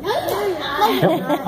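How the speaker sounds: squeaky high puppet pipe-in; ventriloquist puppet voice. Acted. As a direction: chirp it like a tiny dummy. Ventriloquist magician's high interjection.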